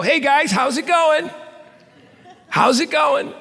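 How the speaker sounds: high, light and casual, strolling past; casual breezy greeting. Retold or imitated. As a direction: toss off a cheerful hey as you stroll by. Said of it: "he's gonna walk on by and go".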